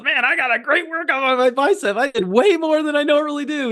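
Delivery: high voiced bragging friend; high gleeful imitation of a friend; imitating Tom. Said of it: "he goes".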